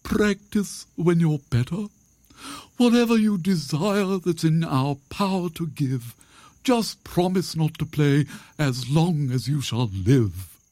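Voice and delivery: high-pitched owl, imploring; pleading owl. Acted. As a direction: bargain earnestly in a high owl voice.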